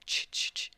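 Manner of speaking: repeated "ch" tongue sounds; voiceless little clucks to call a baby; coaxing baby noises. Acted. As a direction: soothe a baby with ch-ch-ch. Goku coaxes the baby.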